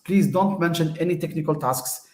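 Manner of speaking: ordinary talk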